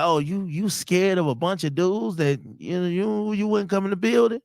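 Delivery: lightly voiced scornful girl on the phone; mocking girlfriend.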